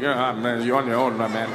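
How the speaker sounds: low-voiced mock sympathy; low, resigned aside; unhelpful onlookers. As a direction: drop the pitch and back away from helping. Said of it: "they just look at him like".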